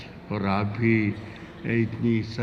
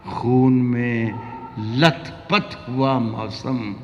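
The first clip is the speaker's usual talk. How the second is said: romantic shayari; much slower than usual. Acted. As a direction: linger over each word. Poet recites slowly.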